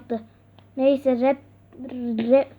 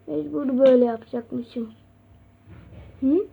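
ordinary talk, nothing marked

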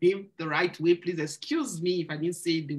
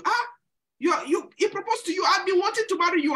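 much higher, louder, rushed pleading; mock eager suitor; 13 semitones higher, 5 dB louder than usual. Imitating the other brother wooing the sister, high and excited. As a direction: gush like an eager rival suitor.

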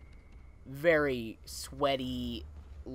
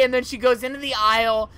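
ordinary talk, nothing marked

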